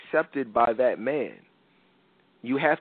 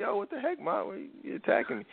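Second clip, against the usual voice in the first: high, breathless yelp at his mom; squeaky disbelief, half whispered; high startled protest; 8 semitones higher, 7 dB quieter than usual. Retold or imitated. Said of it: "I'm like".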